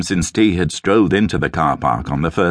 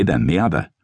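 plain reading voice

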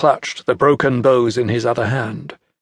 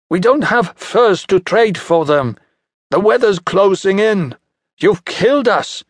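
put-on desperate young hunter; 8 semitones higher, 3 dB louder than usual. Son despairs after bows broken.

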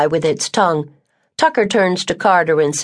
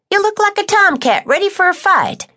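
much higher, teasing woman's voice; Tucker's voice. Acted. Tucker teasing scowling March, high and sharp. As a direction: poke fun at the sulky boy.

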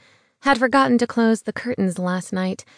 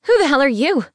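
shocked, higher, louder blurt; 6 semitones higher, 5 dB louder, faster than usual. A narrator's reading. Woman waking beside a stranger blurting in shock. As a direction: blurt it out in alarm. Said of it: "I blurted".

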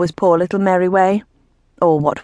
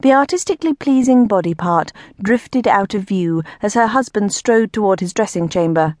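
plain reading voice

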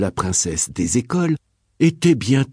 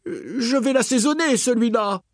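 impatient grumble; 10 semitones higher than usual. Acted. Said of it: "le prince finit par s'impatienter".

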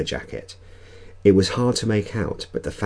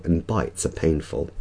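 ordinary delivery, nothing marked

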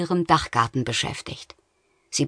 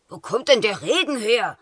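grumpy voice; 5 semitones higher than usual. Acted. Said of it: "schimpfte eine mürrische Stimme".

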